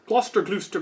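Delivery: ordinary talk, nothing marked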